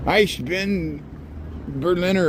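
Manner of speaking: put-on a light, halting German accent quoting Kennedy; half-hearted German accent, reaching for the famous line; Kennedy's German. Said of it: "with a better German accent than me".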